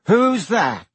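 high, loud startled question. A narrator's reading. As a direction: jump back startled. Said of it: "taking an automatic step backward".

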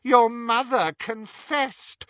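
high, slow sneer. A narrator's reading. Riddle Harry taunts Ron about his mother, sneering. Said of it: "sneered Riddle Harry".

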